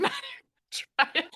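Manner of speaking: laughing through the words, high. Real, not acted. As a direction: tease while cracking up. Laughing at partner giving up.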